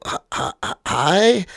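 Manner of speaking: mock-nervous, questioning hello; tentative. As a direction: stutter breathily before daring the greeting. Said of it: "really tentatively go".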